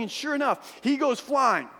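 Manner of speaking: ordinary talk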